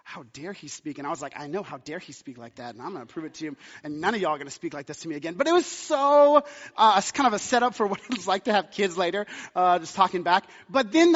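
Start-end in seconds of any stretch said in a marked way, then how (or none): 0.0-5.4 low, very soft outrage; retold affront in a hushed voice; hushed mock indignation. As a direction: act out indignant muttering under your breath. Retold indignant muttering among students.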